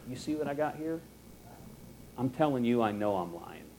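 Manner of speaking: acted sneaky aside, voice dropped; confidential aside. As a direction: slip it quietly to God on the side. Acted secret aside to God.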